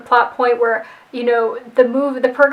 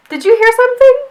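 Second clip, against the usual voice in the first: much higher, louder startled asking; startled question; 9 semitones higher, 5 dB louder than usual. Retold or imitated. Friend hears yelling from the freezer, startled. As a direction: jump at a noise you just heard.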